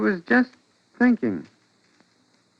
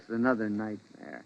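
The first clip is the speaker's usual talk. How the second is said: weary quiet echo; 6 semitones lower, 15 dB quieter than usual. Acted. Weary echo of her words.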